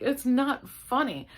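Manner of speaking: high, loud, slow scolding; indignant. Retold or imitated. Scolding husband who laughs, loud and indignant. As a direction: put him in his place.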